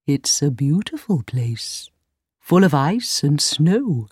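slow, low reindeer voice; dreamy reindeer. A narrator's reading. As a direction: speak slowly and low, remembering home. Old reindeer describes home.

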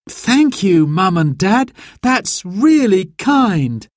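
put-on high, sweetly thankful child's voice; grateful child. A narrator's reading. Boy thanks Mum and Dad, grateful, high.

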